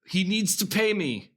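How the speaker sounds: imitating someone refusing to budge; obstinate. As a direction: dig in and insist, lightly acted. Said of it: "Clementine is being very obstinate".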